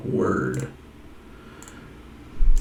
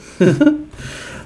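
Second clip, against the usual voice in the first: short high-pitched laugh; 4 dB louder than usual. Real, not acted. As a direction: burst of high, quick laughter. Laughs at own Yoda remark.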